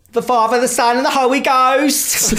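high, drawn-out Essex sermon; hammed-up Essex preacher intoning slowly; really Essex. Host imagines Cheryl doing a religious theme, really Essex. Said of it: "been, like, really Essex with it".

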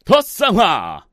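slow, drawn-out ad voice; infomercial tone. Acted. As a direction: stretch the brand name like a commercial. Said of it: "저는 이제 톤으로 알려드리는 거죠".

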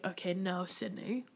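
soft, firm talking herself down; muttering a private pep talk; quiet self-talk. Retold or imitated. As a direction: hush yourself back to sense. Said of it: "and then I have to be like".

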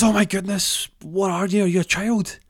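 high, loud burst of disbelief; astonished. Acted. Man first sees the tiny figure, loudly astonished. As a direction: exclaim in shock at what you see.

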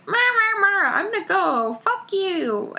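high-pitched mocking of a petulant character; mock whining. Co-host retells a character storming off, mock whining. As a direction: whine it as a high nonsense mimicry.

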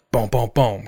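normal talking voice

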